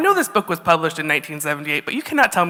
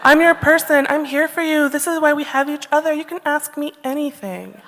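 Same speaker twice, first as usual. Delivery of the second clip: NPR voice; 7 semitones higher than usual. Retold or imitated. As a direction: soothe her like a radio host at the co-op. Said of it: "my best N P R voice".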